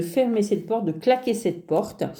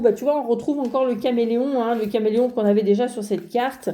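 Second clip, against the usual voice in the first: ordinary talk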